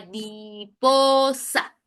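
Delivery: Mariposa slowly split as ma-ri-po-sa; slow syllable count. Shown as a demonstration. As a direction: stretch out Mariposa beat by beat. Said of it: "Cuatro sílabas".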